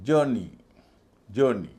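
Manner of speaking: जर्नी with a long vowel and no rolled र; drawn-out जर् in जर्नी; correct pronunciation. Shown as a demonstration. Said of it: "एक अलग तरह का उच्चारण". (जर्नी का सही उच्चारण)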